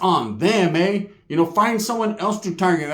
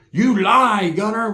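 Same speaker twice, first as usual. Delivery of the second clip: higher, louder mimicked accuser; mock accusation; 4 semitones higher, 4 dB louder, slower than usual. Critic calling Gunner a liar, mock accusing. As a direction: call out the liar in a raised mock voice. Acts out an accuser, then answers.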